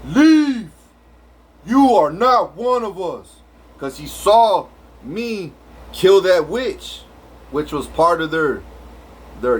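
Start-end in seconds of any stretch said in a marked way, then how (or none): 0.0-3.4 stern alien leader. Retold or imitated. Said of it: "He was all".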